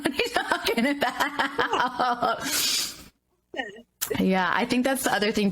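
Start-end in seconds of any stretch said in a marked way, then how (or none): 0.0-2.4 high, slow laughing disbelief. Real, not acted. Podcast host laughing at the cost of publishing. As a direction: laugh it out like it's absurd.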